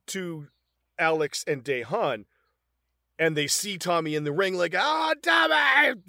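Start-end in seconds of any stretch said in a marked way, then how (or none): 4.8-6.0 high, slow stretched-out yell of Tommy's name; hammed-up call across the arena; imitating his yell. Retelling the film, imitating Alex's yell. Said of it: "Fucking does his yell".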